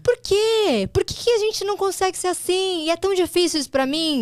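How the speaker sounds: mock-exasperated high call; high exclaim. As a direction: pitch it way up and push it out. Continues her laughing self-quote.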